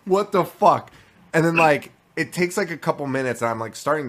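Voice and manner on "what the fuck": higher, louder retold "what on earth" reaction; alarmed disbelief. Dad retelling his missing-son scare, alarmed disbelief. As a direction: act out a parent's alarm, half laughing.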